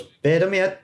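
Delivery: high, loud mock character voice; comic imagined character. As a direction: blurt it high as a joke character. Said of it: "and he goes".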